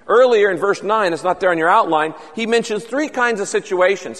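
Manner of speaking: ordinary delivery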